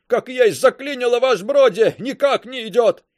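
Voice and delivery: high, loud despairing shout; wailing yell. A narrator's reading. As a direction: howl it, near tears. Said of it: "плачуще проорал Каблуков".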